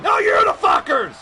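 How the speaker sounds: high, shouted retort; heel outburst. Pretend. Wrestling heel turns on laughing chat, high angry shout. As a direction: throw the insult back at them.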